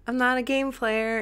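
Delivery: put-on high-pitched adorable girl voice; imitating commercial's Courtney. Host quoting girl from dating ad, high cute voice. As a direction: mimic a cutesy ad character. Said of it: "then she'll be like".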